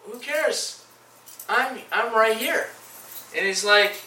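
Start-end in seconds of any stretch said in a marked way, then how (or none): 0.1-2.7 voicing Jesus. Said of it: "it's like".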